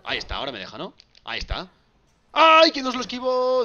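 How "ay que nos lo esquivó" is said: dismayed cry. Real, not acted. Move dodged in game.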